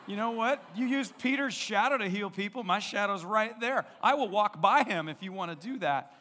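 hammed-up eager offer to heal; mock bold bargaining. Bargaining with the Holy Spirit aloud.

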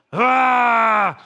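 loud hammed-up roar; mock angry yell. Preacher acting out fretting over the wicked, loud mock angry yell. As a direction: let out a mock rage shout.

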